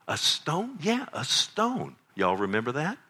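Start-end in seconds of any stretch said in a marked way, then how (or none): 0.1-0.8 high, rising disbelief on A STONE; childlike astonished echo; amazed child. Acted. Child amazed at dad's story. 0.8-2.0 high, slow, drawn-out A STONE; acted dad relishing the child's amazement; emphatic confirmation.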